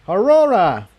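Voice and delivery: high call across the house to another room. A narrator's reading. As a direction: call her name toward the closed doors. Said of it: "Iron Mane called".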